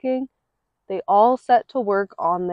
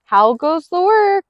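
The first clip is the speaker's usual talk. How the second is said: farmer calling; 6 semitones higher, 4 dB louder than usual. Acted. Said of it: "he called".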